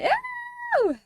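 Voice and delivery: very high retold squeal. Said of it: "and then I squealed".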